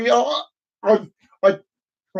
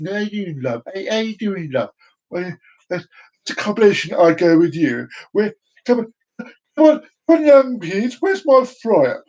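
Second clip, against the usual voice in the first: put-on lightly done common London accent; a hint of a cheeky common accent, calling out; 2 semitones higher than usual. Impression of Donna as a common Londoner, lightly done. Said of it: "I come all common as muck like this".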